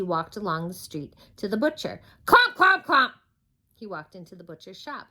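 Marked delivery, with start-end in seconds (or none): 2.2-3.1 loud high clomps; stomping clomps. A narrator's reading. Mister Noisy stomps down the hill, loud clomps. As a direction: stamp each clomp out.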